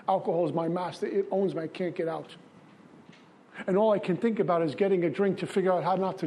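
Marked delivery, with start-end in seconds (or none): 1.6-2.3 softer, falling resigned murmur; said under the breath, giving up; quiet despair. Real, not acted. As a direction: let it sink away, defeated. Despairing repeated refrain, dropped low.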